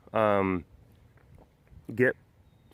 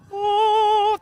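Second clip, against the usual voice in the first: mock awed gasp; 5 dB louder than usual. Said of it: "Pride people who were just like".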